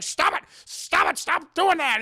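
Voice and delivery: high, loud acted shouting; panicked yelling. Dad to possessed wife, panicked shout. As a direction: yell for it to end.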